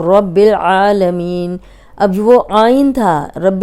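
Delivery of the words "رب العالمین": رب العالمین said slowly with a clear ع; slow down and sound the ain in العالمین; correct tajweed. Shown as a demonstration. Teacher showing correct recitation, slow and clear. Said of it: "آپ کو پتہ چلے گا".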